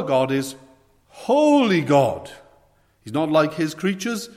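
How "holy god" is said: slow, loud and drawn out; proclaiming holiness. Real, not acted. Preacher to congregation, slow loud proclamation. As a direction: hold each word out with weight.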